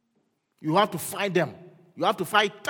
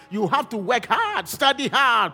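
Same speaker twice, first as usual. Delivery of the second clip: loud, high list, each demand punched; full-force preaching; 6 semitones higher, 7 dB louder, slower than usual. Real, not acted. Preacher urging young people to work hard, forceful exhortation. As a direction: drive each command home hard.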